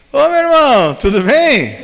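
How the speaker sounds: bem mais agudo e mais alto, cheio de alegria; com alegria. Retold or imitated. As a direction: cumprimente como um velho amigo feliz. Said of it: "fala assim com uma alegria". (com uma alegria, bem agudo)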